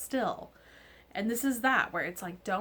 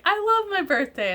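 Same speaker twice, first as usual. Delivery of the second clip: gushing; 10 semitones higher, 11 dB louder than usual. Retold or imitated. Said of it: "I'm like".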